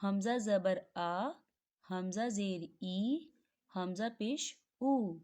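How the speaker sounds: हमज़ा ज़बर आ, हमज़ा ज़ेर ई, हमज़ा पेश उ read slowly, syllable by syllable; slow teacher's spelling drill. Shown as a demonstration. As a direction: spell out each vowel sign slowly for students. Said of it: "Hamza की मिसाल को देखें".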